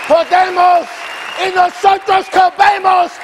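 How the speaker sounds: loud, high preacher's shout; full-force preaching. Acted. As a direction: lift the congregation with every line.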